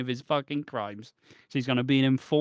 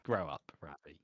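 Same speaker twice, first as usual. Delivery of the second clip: mock stern scold; 9 semitones lower, 10 dB quieter than usual.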